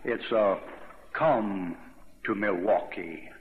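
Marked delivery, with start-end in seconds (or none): 1.1-3.3 deep, slow beer-commercial announcer; radio announcer's voice. Retold or imitated. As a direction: imitate a whiskey-soaked announcer, low and drawn out. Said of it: "it's a voice like that".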